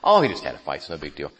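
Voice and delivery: downplaying. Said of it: "She kind of downplays it".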